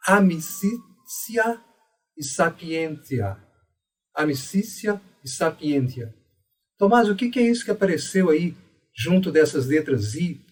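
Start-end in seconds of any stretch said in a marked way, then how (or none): 0.1-3.4 amicitia said slowly as "amisísia", ti like si; traditional Latin pronunciation. Shown as a demonstration. Teacher models the ti as si slowly for students. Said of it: "eu diria". 4.1-6.1 amicitia as "amisísia", sapientia as "sapiência"; traditional Latin pronunciation. Shown as a demonstration. Teacher repeats the example words for the class. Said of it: "eu diria".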